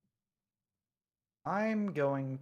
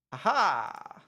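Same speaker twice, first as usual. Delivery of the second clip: triumphant aha; 3 semitones higher, 7 dB louder than usual. Real, not acted. Triumphant play reveal.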